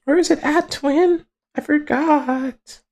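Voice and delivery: very high squeak, slowing at the end; forgetful falsetto. Pretend. Searching for a forgotten entry, squeaky falsetto. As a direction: trail it out slowly in falsetto.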